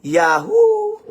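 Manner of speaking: high, loud whoop of joy; excited cheer. Real, not acted. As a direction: whoop it out, thrilled. Excited cheer about the business. (masayang sigaw)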